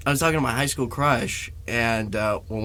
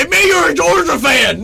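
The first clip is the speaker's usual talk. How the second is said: high, loud mock yell; bully yelling; 14 semitones higher, 7 dB louder than usual. Guys pegging him with tennis balls, yelling. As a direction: hurl it like an attacker. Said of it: "guys pegged me".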